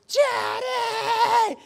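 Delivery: very high, loud imitated kid squeal; child's excited shout. Kids greeting dad at the door, imitated high shout.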